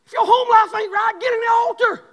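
loud, high shouted preaching; full-force preaching. Acted. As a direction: preach it hard and loud to the church.

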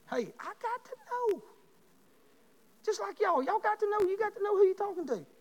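high-pitched teasing voice, pushed up; playful high aside. Acted. Preacher teasing his congregation in a high playful voice.

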